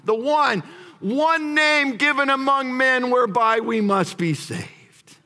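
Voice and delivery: ordinary talk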